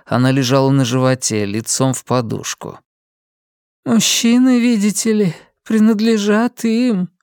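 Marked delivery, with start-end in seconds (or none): 3.9-7.1 Linda to herself. A narrator's reading. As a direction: speak as if to someone inside. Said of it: "точно и не к нему обращаясь".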